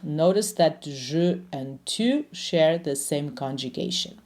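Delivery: ordinary talk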